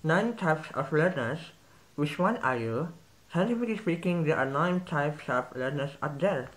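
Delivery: slow, muffled reading around a pencil; effortful speech with a pencil in the mouth; pencil between the teeth. Shown as a demonstration. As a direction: read it biting a pencil, words slurred. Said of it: "Seperti saya. ... pasti kalian nggak paham".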